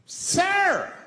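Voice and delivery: high, loud outraged passenger; freaked-out yell. Retold or imitated. Freaked-out bus passenger to a smoker, outraged high yell. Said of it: "people freaked out".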